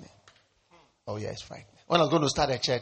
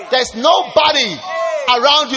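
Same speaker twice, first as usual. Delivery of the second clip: higher, louder, driving sermon delivery; fiery preaching; 8 semitones higher, 6 dB louder than usual. Acted. Pastor stirring the congregation, preaching at full force.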